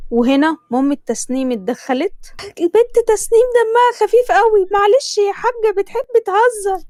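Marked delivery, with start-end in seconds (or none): 2.6-6.8 higher, louder pushy Egyptian mother; a mother hurrying her daughter, pitched up; Tasneem's mother. Acted. Mother voiced in story. (صوت أم مصرية عالي ومستعجل)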